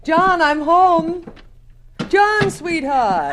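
high, slow call through the flat. Acted. Wife arriving home calls for her husband, drawn-out call. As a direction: sing out his name from the doorway.

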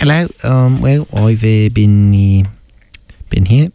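put-on a touch of New Zealand accent, halting. Greeting the host, lightly held New Zealand accent. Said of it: "That's a New Zealand accent?".

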